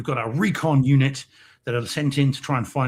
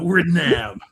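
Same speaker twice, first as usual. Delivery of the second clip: higher, hammed-up American accent; badly faked American "Nam"; 5 semitones higher, 4 dB louder than usual. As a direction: do your worst American soldier accent. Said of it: "best bad American accent".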